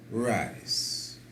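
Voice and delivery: ordinary delivery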